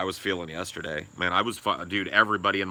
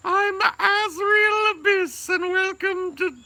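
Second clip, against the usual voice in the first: much higher, slow, drawn-out goth host voice; Azriel Abyss impression; 5 dB louder, much slower than usual. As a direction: draw it out like a gloomy goth TV host. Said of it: "His character on Saturday Night Live".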